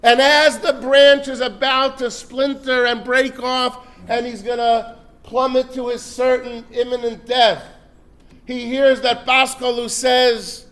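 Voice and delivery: loud, high, urgent storytelling; building suspense. A narrator's reading. Preacher builds the fall to the crowd, loud and urgent. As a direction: drive the tension up.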